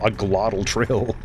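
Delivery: glottal trill with a gargled R, slowly; throaty r. Shown as a demonstration. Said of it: "Gargling your R's".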